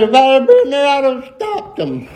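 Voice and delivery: high-pitched mock sobbing preacher; mock tearful. TV preacher tearfully wishing he'd stopped them, mock sobbing. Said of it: "he was in tears and his nose was running".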